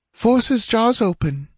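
high, urgent female command; commanding woman. A narrator's reading. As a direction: give the order in a high woman's voice. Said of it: "she commanded".

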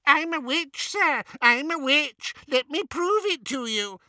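very high mocking squeak; squeaky impersonation. A narrator's reading. Travers mocking a beggar, squeaky impersonation. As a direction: mimic a pleading witch in a squeaky voice. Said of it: "he said in a squeaky impersonation".

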